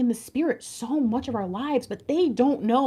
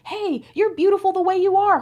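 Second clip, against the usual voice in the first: tender compliment; 8 semitones higher, 3 dB louder than usual. Pretend. Imagined compliment to men.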